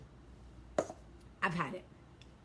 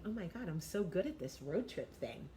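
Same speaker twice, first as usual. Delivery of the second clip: soft, breezy mock of a smug passenger; mocking the new girl; 5 semitones lower, 9 dB quieter than usual. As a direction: lightly parody her self-satisfied chirp. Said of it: "And she's going".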